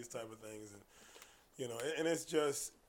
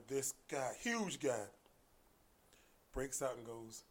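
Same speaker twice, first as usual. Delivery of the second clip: ordinary talk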